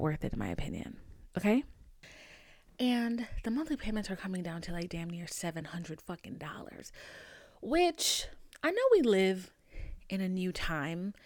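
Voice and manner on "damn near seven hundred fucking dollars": usual speaking voice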